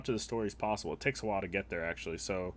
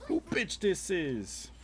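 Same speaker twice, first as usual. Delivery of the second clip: Who pitched high, slow and drawn-out; Who high and slow, falling away; stress on Who; 9 semitones higher, much slower than usual. Shown as a demonstration. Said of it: "It's my favorite phrase".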